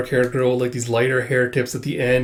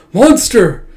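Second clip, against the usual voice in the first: high, very loud cry; horrified shout; 9 semitones higher, 10 dB louder than usual. Acted. Manga voice crying out at the girl, horrified shout. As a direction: shout it out in acted horror.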